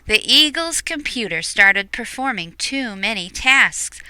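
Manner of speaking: usual speaking voice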